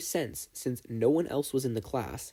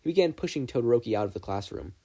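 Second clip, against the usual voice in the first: plain reading voice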